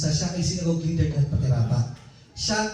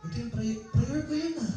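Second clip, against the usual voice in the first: raised high and softer, a whiny complaint to the Lord; envious pleading prayer; 6 semitones higher, 6 dB quieter than usual. Retold or imitated. Preacher voicing a jealous believer praying, high whiny plea. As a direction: pitch it up, a hurt mock plea.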